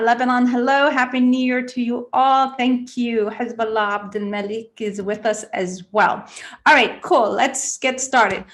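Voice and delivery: normal talking voice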